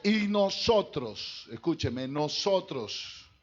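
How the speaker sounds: slow, loud stress on "nosotros"; emphatic preaching. Real, not acted. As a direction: drag out "nosotros" to make the church listen. ("nosotros" lento y enfático)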